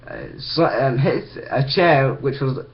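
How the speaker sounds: normal talking voice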